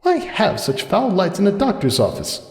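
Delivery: put-on high, soft young mare voice, wondering; a lighter voice for Cupcake musing on the lights; Cupcake's voice. Cupcake wondering aloud to herself in a high, soft voice.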